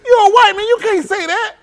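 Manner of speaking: high, loud laughing scold; laughing protest. Real, not acted. Teasing co-host over the word.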